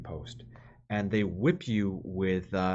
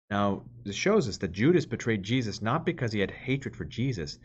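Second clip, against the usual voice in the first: usual speaking voice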